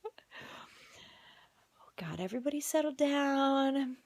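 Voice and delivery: hissing at the whole house to settle down; exasperated whisper. Retold or imitated. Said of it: "I'm like".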